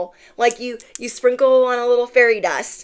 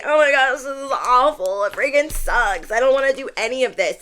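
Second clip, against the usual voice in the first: lightly exaggerated, higher-pitched griping; mock complaining; 5 semitones higher than usual. Everybody in their thirties dreading it, mock complaining. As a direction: moan like someone fed up, pitched up.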